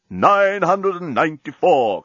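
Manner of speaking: talking bomb. Acted. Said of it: "continued the bomb".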